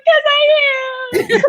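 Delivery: high, drawn-out comic wail; mock bawling. Retelling her reply about being married and pregnant, mock bawling. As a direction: stretch it into a pretend sobbing squeal. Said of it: "And I just bawling".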